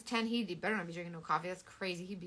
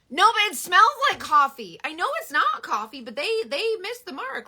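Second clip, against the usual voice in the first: emphatic complaint; 9 semitones higher, 11 dB louder than usual. Real, not acted. Complaining about coffee scent.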